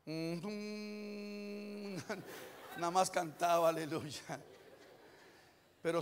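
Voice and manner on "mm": long, soft hum held on one note; sung hum. Retold or imitated. Last words of the man who sang his speech, sung hum. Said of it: "Sus últimas palabras".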